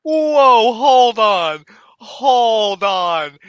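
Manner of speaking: loud, stunned halt to everything; stunned outburst. Real, not acted. Reactor stops the battle tape after a wild bar, stunned outburst. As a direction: cut in loud, floored by what you heard.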